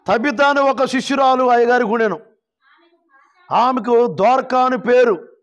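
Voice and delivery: high, measured Bible reading; scripture proclamation. Real, not acted. Preacher reads Tabitha's story to the congregation, high proclaiming voice. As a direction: project each verse in a sustained preacher's voice.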